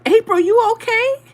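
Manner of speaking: high, loud imitation of a concerned mother-in-law; mother-in-law calling. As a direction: call out high and loud, mimicking her. Acting out mother-in-law calling through door.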